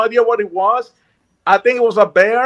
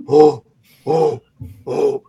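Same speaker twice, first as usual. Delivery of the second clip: low, huffing animal grunts; imitated animal breathing; 7 semitones lower, much slower than usual. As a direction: puff out low grunts like a running beast. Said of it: "like that, right?".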